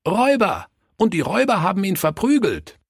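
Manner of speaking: put-on high, eager child voices; excited children. A narrator's reading. Children finishing the pastor's story, excited and high. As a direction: burst in with the rest of the story, high.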